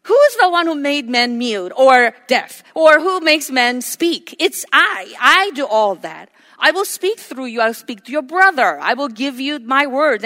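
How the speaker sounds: acted angry rebuke; God. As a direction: thunder indignantly at a reluctant servant. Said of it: "The Lord's anger burned against Moses".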